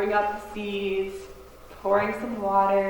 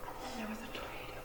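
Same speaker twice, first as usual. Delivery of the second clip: whisper; 18 dB quieter, much faster than usual. Acted. Servant dreads telling news.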